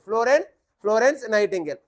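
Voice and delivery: usual speaking voice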